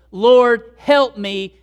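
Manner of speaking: loud, acted cry for help; exclaimed plea. Woman crying out to the Lord for help, loud acted plea. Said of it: "exclamation point".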